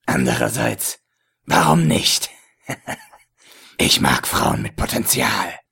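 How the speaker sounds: low, leering villain with a chuckle; lecherous villain voice. A narrator's reading. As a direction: play the sleazy dealer, slow and low. Ägypter, gelesen mit Figurenstimme. (tiefe, lüsterne Schurkenstimme)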